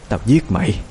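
crazed woman muttering to herself; deranged muttering. A narrator's reading. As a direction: mutter a threat like someone possessed. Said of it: "miệng bà ta lại lẩm bẩm".

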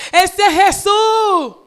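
slow, loud, high cry of recognition; joyful shout. Retold or imitated. Disciple recognizing the master's voice, shouting slowly. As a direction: call out his name, overjoyed. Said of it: "y lo gritó".

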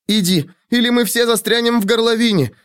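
high desperate urging; urgent shouting. A narrator's reading. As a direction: push him away, shouting. Urging him to flee.

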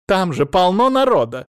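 high, loud protest; indignant interjection. A narrator's reading. Mother-in-law heckles the TV speech, indignant shout. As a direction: cry out over the broadcast.

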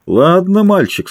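put-on easygoing drunk skipper. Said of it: "пьяным голосом".

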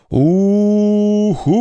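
long, high ghost hoot; ghostly howl. A narrator's reading. Girl shows siblings how to haunt, high ghostly howl. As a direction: howl "oo-hoo" like a party ghost. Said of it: "Давайте изобразим призраков. Войте".

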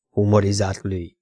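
plain reading voice

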